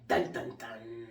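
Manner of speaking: low, dark dun dun dun; ominous sting. Pretend. Warning Donnie with a low ominous dun dun dun. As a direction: hum a cheesy suspense cue.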